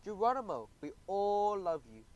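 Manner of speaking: high, slow sung-out greeting; group chorus. Acted. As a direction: call it out together, drawn out. Friends' loving chorus.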